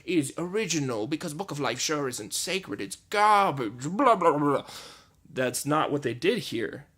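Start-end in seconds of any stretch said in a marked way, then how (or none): 0.0-4.6 snobby grumble. Retold or imitated. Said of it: "I was like".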